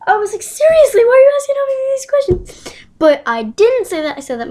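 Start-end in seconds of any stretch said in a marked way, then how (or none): 0.4-2.8 very high, loud squeal of disbelief; acted-out shrill, fast indignation; exasperated outburst. Acted outburst said in his head.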